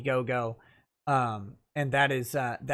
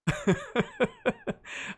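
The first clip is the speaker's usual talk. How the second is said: high laugh; 4 dB quieter than usual. Real, not acted.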